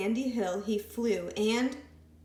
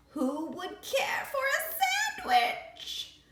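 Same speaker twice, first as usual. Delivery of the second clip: high, slow, drawn-out call; faint distant call; much slower than usual. A narrator's reading. Creature calling to the scouts from afar. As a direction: sing it out like a voice far away. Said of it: "a faint voice far in the distance".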